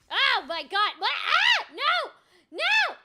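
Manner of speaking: loud, high-pitched bursts of alarm; panicked screaming. Real, not acted. Player panicking as the game goes wrong, panicked screams. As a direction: yelp each word in a gaming panic.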